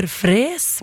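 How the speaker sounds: Fres with a long, drawn-out E; lang E. Shown as a demonstration. Host reading the festival chief's explanation, long E in Fres. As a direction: hold the vowel in Fres. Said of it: "med lang E".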